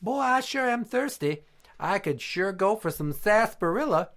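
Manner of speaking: high, dainty put-on cowboy voice; high-voiced short cowboy ordering a drink; pink cowboy. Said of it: "he says to the bartender".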